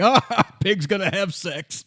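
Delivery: a mock kid's jeer, laughing. The kids mocking the detective, laughing. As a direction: laugh through the jeer like a snotty kid. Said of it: "The kids are saying".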